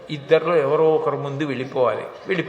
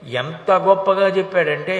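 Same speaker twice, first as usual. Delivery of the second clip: ordinary talk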